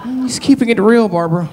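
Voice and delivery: a polite audience lady murmuring to her friend. Retold or imitated. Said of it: "with your arms crossed like her".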